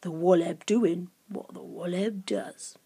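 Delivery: low, slow, hushed creature voice; ominous and drawn out; dark creature Grunt. A narrator's reading. Creature answers Lucy's riddle, darkly. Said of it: "said Grunt darkly".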